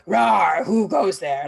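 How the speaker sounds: loud roaring orc challenge. Acted. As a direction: growl it out like an orc on guard. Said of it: "you come across the orcs".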